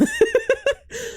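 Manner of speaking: bright burst of high laughter. Real, not acted. Retelling a friend's teasing to viewers, a high laugh.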